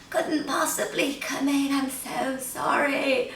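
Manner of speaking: soft, high, feeble fake-ill voice; sick voice. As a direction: sound too poorly to work. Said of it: "put on my best sick voice".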